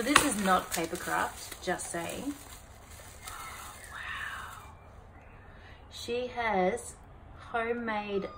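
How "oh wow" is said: whispered wow; hushed amazement; breathy awe. Real, not acted. Amazed at gift.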